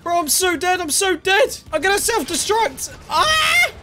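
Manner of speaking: losing it as the fight collapses; panicking gamer. Real, not acted. Out of mats, about to die.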